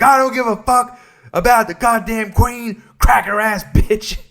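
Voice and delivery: loud, high mock-American outburst; angry American. Imagined American ranting about the queen, mock outrage. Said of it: "probably in America, just being like".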